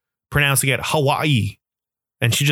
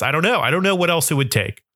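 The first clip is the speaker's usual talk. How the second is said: ordinary talk, nothing marked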